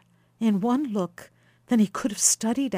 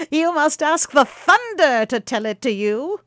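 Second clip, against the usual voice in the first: mysterious; 8 semitones higher, 8 dB louder than usual. A narrator's reading. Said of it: "putting on a mysterious look".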